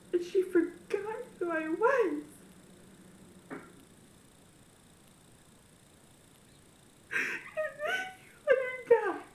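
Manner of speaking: tearful and pleading; crying. Real, not acted. Telling viewers how her grandmother died, crying. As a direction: voice breaking, rising high.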